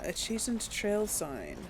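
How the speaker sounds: ordinary delivery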